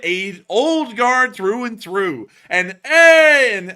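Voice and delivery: loud, high, drawn-out announcer hype; hyped shout-out. Real, not acted. Streamer announcing a loyal donor's super chat, loud hype. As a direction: blast it out, stretching the words and climbing high.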